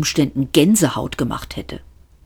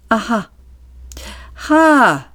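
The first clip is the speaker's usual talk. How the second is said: mock the question; a thin-lipped sarcastic scoff; sarcastic aha; 7 semitones higher than usual. Waiter's sarcastic laugh.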